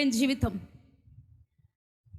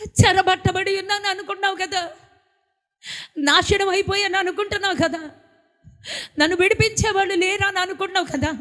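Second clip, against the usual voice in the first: building preaching; 5 semitones higher than usual. Real, not acted. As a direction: drive the rhetorical questions to a climax.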